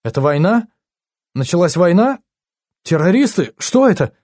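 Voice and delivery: acted panic; panicked questions. A narrator's reading. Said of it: "в панике спрашивает Энни".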